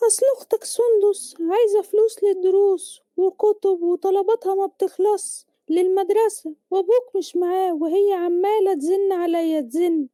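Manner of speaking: slow, high-pitched motherly voice; worried mother. Acted. Mother telling her son about his sister's needs, worried high voice. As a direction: confide your money worries slowly, pitched high. Mother explains money troubles.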